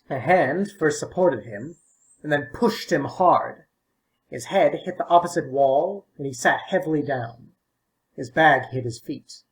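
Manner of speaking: usual speaking voice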